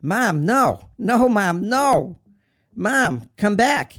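high, loud anguished screaming; screamed plea. Retold or imitated. Son calling his dead mother back, screaming. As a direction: scream after someone slipping away. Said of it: "And I screamed".

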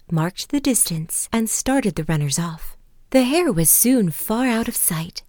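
lively read-aloud fable; story narration. A narrator's reading. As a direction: tell it like a bedtime fable.

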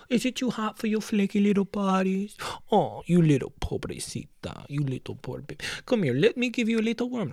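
put-on high cooing baby talk; teasing the little fish in sweet baby talk. Mock-talks to the fish.

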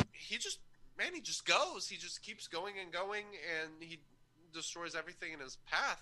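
distant, muffled audio; far from mic. Real, not acted. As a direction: voice heard from across the room. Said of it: "Your audio just got screwed up".

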